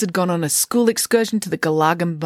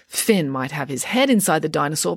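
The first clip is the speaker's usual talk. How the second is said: ordinary delivery, nothing marked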